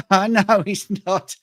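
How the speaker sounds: slow, high, through laughter; laughing reply. Real, not acted. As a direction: laugh while conceding the point. Laughing at teasing about accent.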